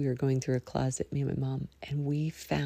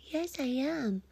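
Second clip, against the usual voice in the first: voicing the sleepy dog; 8 semitones higher than usual. Podcaster voicing her dog's reply, high and sleepy. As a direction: speak for the dog, sleepy and sweet.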